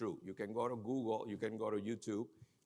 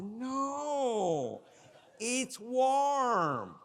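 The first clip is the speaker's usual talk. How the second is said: high, loud, drawn-out singsong contradiction; mocking dismissal; 11 semitones higher, 7 dB louder, much slower than usual. Friend brushing off cold person, high mocking whine.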